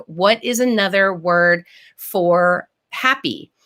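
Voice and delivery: ordinary delivery, nothing marked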